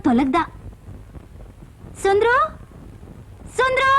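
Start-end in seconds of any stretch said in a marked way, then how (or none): 2.0-3.9 servant's name called, second call higher. Acted. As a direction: call someone in from another room. (ಕೂಗಿ ಕರೆಯುವ ದನಿ)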